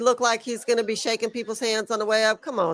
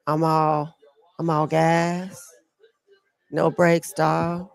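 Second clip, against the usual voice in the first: low, drawn-out rapper drawl; low-pitched and laid back, slowly stretched; 6 semitones lower, slower than usual. Acted. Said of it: "Oh, that's [name]".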